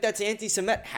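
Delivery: high mock complaint; mock accuser. Imitating someone who objects to criticism, mock indignation. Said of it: "and you're like".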